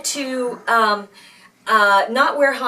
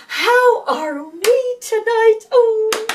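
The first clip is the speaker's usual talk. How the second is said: much higher, slow, cooing sing-song; gushing piano teacher; 11 semitones higher, 5 dB louder, much slower than usual. Retold or imitated. Teacher doting on the brother, high cooing voice. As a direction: coo sweetly at the favourite pupil. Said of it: "she would be telling my brother".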